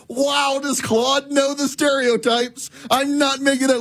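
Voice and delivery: high-pitched, just out of a laughing fit; real giddy, high-pitched amazement; amused disbelief. Real, not acted. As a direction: marvel in a high voice, still tickled. Still cracking up at the reply.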